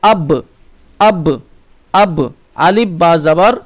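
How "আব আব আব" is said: আব with the ব bouncing out after a short stop; qalqalah bounce. Shown as a demonstration. Teacher showing students the qalqalah echo on আব. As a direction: let the ব of আব spring off the lips. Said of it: "এটা করতে হবে কি".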